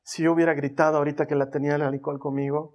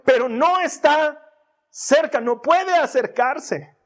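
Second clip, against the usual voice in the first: loud, higher shouted preaching; shouting preacher; 4 semitones higher, 5 dB louder than usual. Acted. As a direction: shout like the roaring lion. Devil shouts lies, contrasted.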